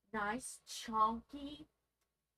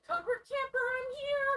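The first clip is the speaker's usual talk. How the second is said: playful announcing; 12 semitones higher, 6 dB louder than usual. Pretend. Playful loud high announcement.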